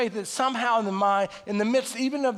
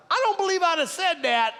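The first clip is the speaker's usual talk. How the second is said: a raised voice of conscience; 9 semitones higher than usual. Retold or imitated. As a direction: chide yourself in a higher voice. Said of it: "those voices".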